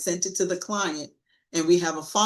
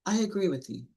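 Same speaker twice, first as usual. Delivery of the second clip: soft, even I agree with you; gentle calm concession; calm agreement; 6 dB quieter than usual. Shown as a demonstration. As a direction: say it quietly and calmly. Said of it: "Just very calmly say".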